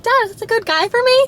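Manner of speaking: put-on high, girlish asking voice; a light, hopeful daughter's question; imagined daughter asking dad. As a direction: play a teen seeking a dad's approval. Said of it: "not being like".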